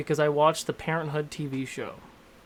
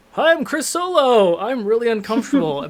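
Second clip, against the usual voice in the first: mock nervous intro, much higher and louder; freaking out; 7 semitones higher, 8 dB louder than usual. Nervous first-time DM introducing himself on the show, mock freak-out. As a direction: imitate a panicky, over-loud self-introduction. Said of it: "out there literally freaking out".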